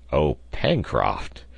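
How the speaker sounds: low, slow, winded words; recovering breath. A narrator's reading. Reporter released from a tight hug, recovering his breath. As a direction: get the name out after being squeezed. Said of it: "recovering his breath at last".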